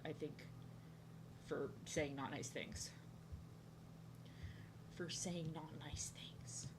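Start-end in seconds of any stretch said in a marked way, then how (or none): 5.0-6.7 very slow, breath-only whisper; whispered repeat. Real, not acted. Telling viewers why she got fired, whispered. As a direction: drop to a slow secretive whisper.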